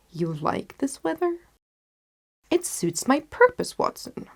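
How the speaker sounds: shrugging it off with relish; Holmes wry and cheerful. Acted. Holmes voiced in story.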